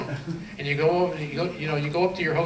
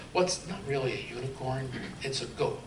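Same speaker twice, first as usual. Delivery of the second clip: low, hushed retold admission; confidential aside; 4 semitones lower, 9 dB quieter than usual. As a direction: drop the voice for a quiet confession. Confidential reply to customs officer, retold.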